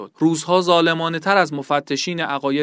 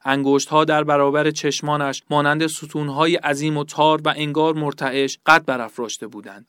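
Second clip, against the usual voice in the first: ordinary delivery, nothing marked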